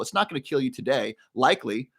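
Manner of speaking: normal talking voice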